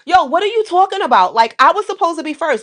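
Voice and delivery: high-pitched, indignant New York accent. Shown as a demonstration. Girl protesting her turn at double dutch, New York accent. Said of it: "give you my, um, New York accent".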